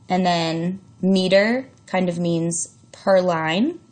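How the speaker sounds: ordinary talk, nothing marked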